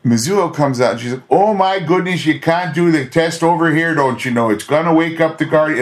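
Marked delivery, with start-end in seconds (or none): 1.3-5.8 imitating a hammed-up Midwestern accent; a light Minnesota lilt, higher and chirpy. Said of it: "this Midwesty sorta".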